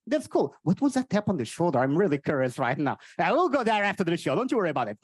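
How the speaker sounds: ordinary talk, nothing marked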